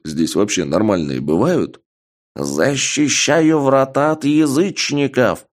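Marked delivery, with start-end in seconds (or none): 2.4-5.5 put-on high, slow old man battle cry; old man exclaiming. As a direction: proclaim it like a zealous old guard. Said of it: "воскликнул дед".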